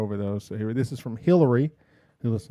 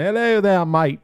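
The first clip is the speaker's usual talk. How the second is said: high, loud, hammed-up Australian accent; a deliberately poor Australian greeting; bad Australian accent; 7 semitones higher, 5 dB louder than usual. Shown as a demonstration. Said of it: "an Australian accent".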